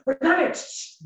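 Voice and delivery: loud slow drawn-out shout; 1 drunk yell. Retold or imitated. Drunk boyfriend yelling at her in the disco, loud and slow.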